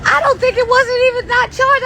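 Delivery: high, loud flustered exclamation; real shock. Real, not acted. Realising the speaker was not charged, high flustered shock. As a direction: blurt it out, caught off guard. Discovers speaker problem mid-stream.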